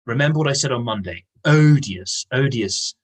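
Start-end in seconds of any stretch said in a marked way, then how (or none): 1.5-3.0 Odious punched with weight; stressed odious. Shown as a demonstration. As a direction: lean hard on Odious. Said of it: "very strong adjective".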